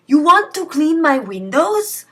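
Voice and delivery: dad mocking the phone scammer in a put-on accent. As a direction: ham up dad's silly put-on accent to tease the scammer. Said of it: "I just felt like doing an accent".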